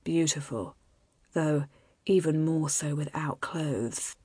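low, soft near-whisper to a lover; intimate murmur. A narrator's reading. Husband admiring his wife up close, intimate murmur.

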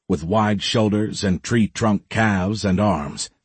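plain reading voice

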